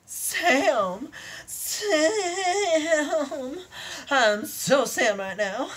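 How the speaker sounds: high, loud, ecstatic cries; ecstatic cry. Acted. Unicorn crying out to her lover in high ecstasy. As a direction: cry out the word like pure bliss.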